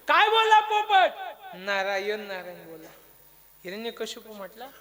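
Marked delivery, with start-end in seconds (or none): 0.1-1.1 high, loud prompt; calling out question. Acted. Preacher quizzes listeners about the parrot, calling out the question. 1.5-2.9 low, subdued reply; low answer. Acted. Preacher answers about the parrot, low answer. Answer after loud question.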